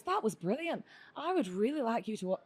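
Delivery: badly faked Scottish accent; terrible Scottish accent. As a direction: have a poor go at a Scot. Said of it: "this was a really terrible Scottish accent".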